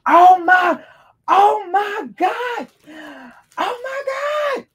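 high, loud squealing oh my God; excited shriek. Real, not acted. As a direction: squeal with delight at the gift. Overjoyed at the gift in box.

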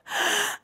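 sudden intake of breath; real startled gasp; shocked gasp. Real, not acted. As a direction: gasp at the surprise. Shocked reaction to the show.

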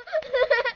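short high happy giggle; child's happy high giggle. Acted. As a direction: giggle with delight.

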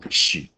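嘘 hissed softly as an sh; whisper-quiet 嘘 to answer the quiz; sh sound. Shown as a demonstration. Teacher answering own quiz with a soft 嘘. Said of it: "T I都念什么".

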